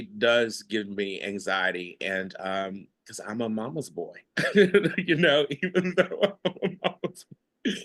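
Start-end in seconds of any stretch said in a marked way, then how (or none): 4.4-7.7 real laughter through the words; high, giggly confession, laughing at yourself. Real, not acted. Laughs at his own confession.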